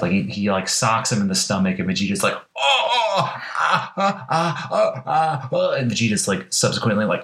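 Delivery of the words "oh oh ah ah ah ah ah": high strained grunts of pain; pained groans. Retold or imitated. Vegeta punched in the stomach, mock pained groans. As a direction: groan like you just got gut-punched.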